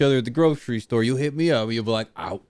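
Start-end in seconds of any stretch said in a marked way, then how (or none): 2.2-2.4 breathy secret whisper. Pretend. Imagined fan at grocery store.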